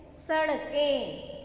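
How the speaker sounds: सड़कें drawn out slowly with a clear retroflex "ड़"; teacher modelling सड़कें slowly for repetition; slow model word. Shown as a demonstration. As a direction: stretch सड़कें so the children hear every sound. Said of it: "हम क्या बोलते हैं?".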